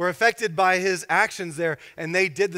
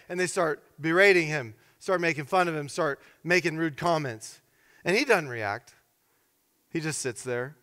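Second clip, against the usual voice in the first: normal talking voice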